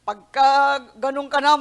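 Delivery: ordinary talk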